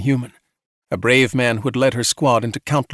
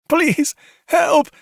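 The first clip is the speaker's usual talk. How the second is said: desperate plea; 3 dB louder than usual. A narrator's reading. Cassie pleads in agony.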